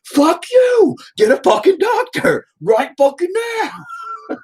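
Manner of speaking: very high, drawn-out re-enacted scream; furious screaming. Father demanding a doctor for son, furious high scream. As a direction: stretch the demand into a frantic shriek. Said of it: "I was just pissed".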